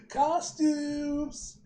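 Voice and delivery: high, drawn-out cheer; silly put-on voice. Friend cheering for costumes, silly high voice. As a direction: call it out high and long. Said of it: "why I'm talking like this".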